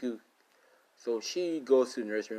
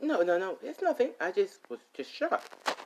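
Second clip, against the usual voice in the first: high, flustered no-no-no, slowing at the end; flustered denial; 10 semitones higher than usual. Acted. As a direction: scramble to cover the shock, pitched way up. Ochako caught off guard.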